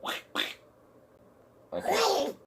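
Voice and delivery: high squawky cartoon duck; Donald Duck voice. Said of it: "do the Donald Duck voice".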